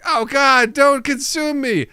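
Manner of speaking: high, slow frightened plea; pleading cereal. Pretend. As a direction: beg for your life as tiny food. Cereal begging not to be eaten.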